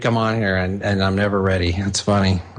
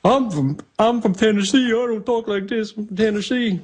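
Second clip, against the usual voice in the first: much higher put-on Southern voice; Kaufman impression; 12 semitones higher than usual. [name] imitates the Kaufman wrestling bit, high put-on voice. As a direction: squeak it like the Kaufman character. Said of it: "that Kaufman bit is classic".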